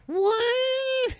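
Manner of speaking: tiny squeaky monster cry, way up high; baby Fel Reaver squeak. Shown as a demonstration. Showing a friend how the baby Fel Reaver should sound, squeaky cry. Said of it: "what a baby Fel Reaver would sound like".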